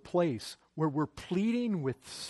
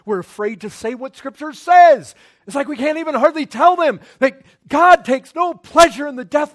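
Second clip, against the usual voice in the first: loud, higher urgent sermon voice; preaching at full force; 5 semitones higher, 13 dB louder than usual. Acted. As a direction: drive the plea home to the congregation. Preaching climax on God's pleading.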